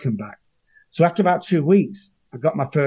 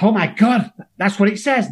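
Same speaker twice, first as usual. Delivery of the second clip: high, loud astonished gasp of discovery; light up as if the page just proved it; amazed; 7 semitones higher, 3 dB louder than usual. Retold or imitated. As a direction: newcomer amazed, finding it in the book. Amazed reading the book.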